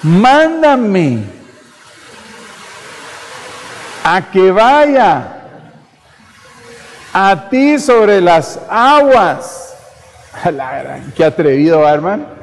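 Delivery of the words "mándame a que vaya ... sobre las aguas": bold dare. Acted. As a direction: stretch it out, daring him. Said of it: "lo retó". (Pedro desafía a Jesús: mándame sobre las aguas)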